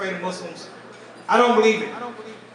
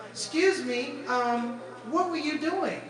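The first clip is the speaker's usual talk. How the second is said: polite lady's voice; 5 semitones higher, 4 dB quieter, much slower than usual. Retold or imitated. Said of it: "she's like".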